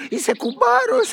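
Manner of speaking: jumps high on κουμπάρος with a falling end; childlike, excited insistence; κουμπάρος sung out high then falling. Acted. Insists he is best man.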